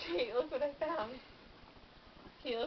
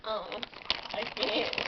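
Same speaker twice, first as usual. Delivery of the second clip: ordinary talk, nothing marked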